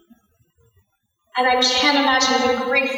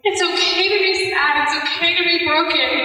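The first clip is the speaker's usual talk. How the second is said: tender motherly comfort; 4 semitones higher than usual. Retold or imitated. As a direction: console a heartbroken child. Mother comforting grieving son, retold.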